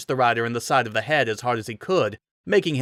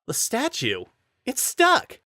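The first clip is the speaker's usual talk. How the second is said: excited realization; 12 semitones higher, slower than usual. A narrator's reading. Rider seeing the statue stuck, rising to a high cry. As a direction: burst out with glee.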